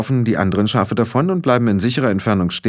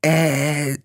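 hesitant, higher-pitched hesitation sound; nervous; 5 semitones higher than usual. Acted. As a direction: stall nervously on a drawn-out filler. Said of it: "sagte Gertrude nervös".

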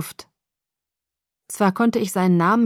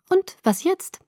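high-pitched, pondering question; musing question, pitched up; thoughtful; 12 semitones higher, slower than usual. A narrator's reading. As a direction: wonder aloud thoughtfully. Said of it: "sagte sie nachdenklich".

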